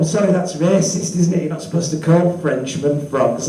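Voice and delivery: usual speaking voice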